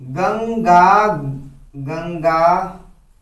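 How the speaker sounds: गंगागमन drawn out very slowly, syllable by syllable; slowly spelled out. Shown as a demonstration. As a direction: stretch out गंगागमन slowly for the class. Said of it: "मान लो दे देंगे कि".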